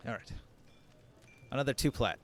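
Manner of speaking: usual speaking voice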